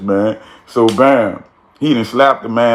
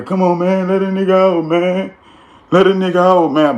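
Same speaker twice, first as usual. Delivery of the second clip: high, whiny begging voice; imitating a trapped man pleading; mock pleading; 7 semitones higher than usual. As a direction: beg at the door like you're trapped. Locked-in man begging to be let out.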